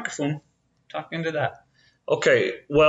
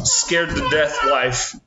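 normal talking voice

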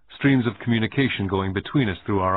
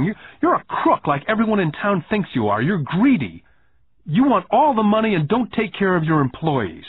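put-on higher, louder boy's voice, hurt and accusing; a nine-year-old venting his grievance; upset young boy; 7 semitones higher, 3 dB louder than usual. Boy confronting Rich Dad, upset.

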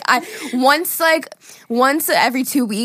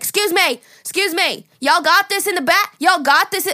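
high, loud, pushy imitation; pushy stranger; 8 semitones higher, 4 dB louder than usual. Retelling the rude Walmart guy, pushy high voice. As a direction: mimic a rude guy calling out.

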